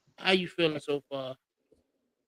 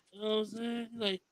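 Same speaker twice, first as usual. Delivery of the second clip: normal talking voice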